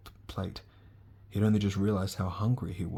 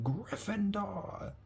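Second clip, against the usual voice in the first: hat shouts; 12 semitones higher, much slower than usual. A narrator's reading. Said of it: "the hat shouted".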